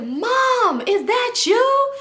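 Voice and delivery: high-pitched, drawn-out call; surprised recognition. Retold or imitated. Young man spots his play mom, imitated surprised call. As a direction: imitate someone calling out in disbelief.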